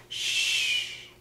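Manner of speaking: long soft "shh"; hushing owl. Shown as a demonstration. Teacher shows the owl's bedtime sound, long shushing.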